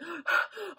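sharp high intake of breath; startled gasp. Retold or imitated. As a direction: gasp as if hit by the realisation. Sudden shock mid-story.

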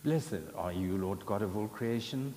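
usual speaking voice

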